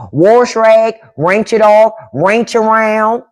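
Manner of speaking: "wash" as worsh, "rinse" as wrench; "wash rag" with an R, "rinse" ending in CH; Southern pronunciation. Shown as a demonstration. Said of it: "But they do say wash rag".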